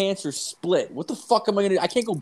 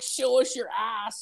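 put-on girls teasing; 10 semitones higher than usual. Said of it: "all the girls were kinda be like".

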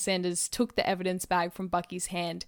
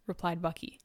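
ordinary delivery, nothing marked

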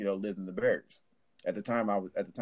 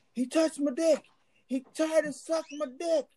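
panicked soldier. Retold or imitated. Panicked soldier reports assault.

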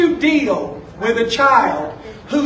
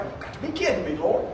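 disbelieving aside; 4 semitones lower, 10 dB quieter, faster than usual. Retold or imitated. Muttered reaction to the Lord's request.

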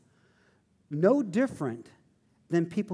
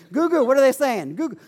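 calling out; 7 semitones higher, 6 dB louder, faster than usual. Retold or imitated. Calling buddy during tongues.

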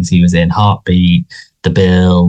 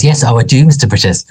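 a hint of gushing young Linda; Linda's eager reply; 6 semitones higher than usual. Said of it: "And she goes".

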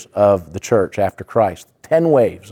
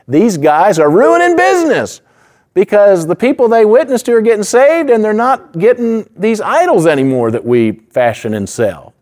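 higher, louder craftsmen's protest; angry silversmiths; 11 semitones higher, 4 dB louder than usual. Retold or imitated. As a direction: rant like tradesmen losing business. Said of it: "I say".

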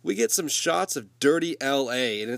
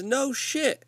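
much higher mock dealer voice; imitating the dealer; 13 semitones higher than usual. Dealer answers the duped buyer, squeaky mock voice. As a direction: toss it off high, like a smug dealer. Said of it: "Dealer says".

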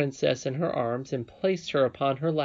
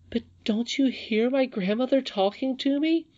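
put-on high, pleading little-girl question; almost crying; 12 semitones higher than usual. A narrator's reading. Irene pleads with Curdie to hear grandmother, nearly crying. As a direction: plead as if near tears, pitched way up. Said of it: "almost crying".

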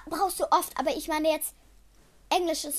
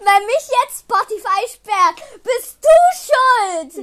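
loud, higher, very slow accusation; mock blame; 7 semitones higher, 11 dB louder, much slower than usual. Host loudly pinning mock blame on her friend. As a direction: drag out the blame playfully.

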